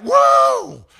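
high loud startled whoa; mock overwhelmed cry. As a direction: yelp it like being swamped. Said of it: "you're like".

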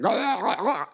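high, repeated retching noises; mock projectile-vomit sounds; mimicked vomiting. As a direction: act out puking in bursts. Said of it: "She was just like".

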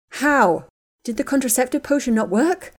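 shocked, panicky questioning; horrified question. Acted. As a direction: blurt it out in horror. Hermione hears shocking news.

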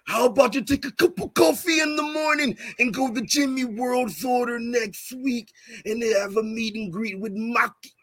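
ordinary talk

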